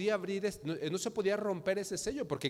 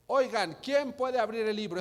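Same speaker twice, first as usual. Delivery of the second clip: raised-voice angel's call; shouted challenge; 5 semitones higher, 5 dB louder than usual. Acted. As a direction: call out loud and high. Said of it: "y gritó".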